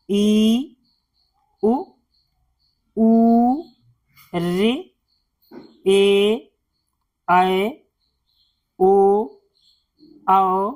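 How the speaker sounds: each Hindi vowel alone, held steady with a pause after; long vowels आ, ई, ऊ drawn out, इ, उ kept short; vowel recitation. Shown as a demonstration. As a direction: recite the vowel chart slowly, one sound at a time. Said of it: "इसका उच्चारण होगा अ".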